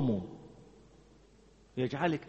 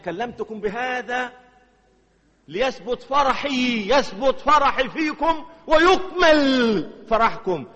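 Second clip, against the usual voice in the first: slow, rising, loud scripture; slow, swelling scripture quote; slow building proclamation; 7 semitones higher, 6 dB louder, much slower than usual. Real, not acted. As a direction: let each word land, building. Quoting Christ with building force.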